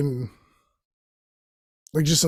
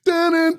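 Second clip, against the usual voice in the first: dramatic sting; 12 semitones higher, 3 dB louder than usual. Retold or imitated. Narrating his conspiracy movie, a high sung dun-dun sting.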